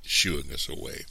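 ordinary delivery

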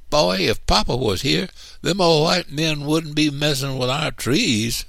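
put-on high-pitched indignant child; little boy declaring. A narrator's reading. Youngest brother declares Papa would stop them, high and defiant. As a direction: boast hotly in a little boy's voice. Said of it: "little man declared".

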